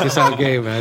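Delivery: giggling as he quotes them again; laughing. Real, not acted. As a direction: repeat the tease while cracking up.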